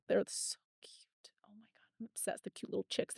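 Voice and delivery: soft, breathy whisper of adoration; hushed, voiceless gushing over tiny chicks. Real, not acted. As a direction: whisper as if overcome by cuteness.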